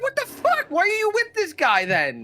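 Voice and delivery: high-pitched incredulous retort; exasperated disbelief. Retold or imitated. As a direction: demand an explanation, voice shooting up. Exasperated retort to film character.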